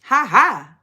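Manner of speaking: usual speaking voice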